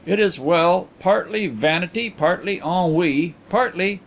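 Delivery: usual speaking voice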